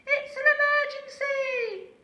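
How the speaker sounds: very high, loud alarm call; urgent shout. A narrator's reading. Lazy owl fakes an emergency to the horse, shouting. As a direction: sound the alarm in a panic. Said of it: "cried Aussie".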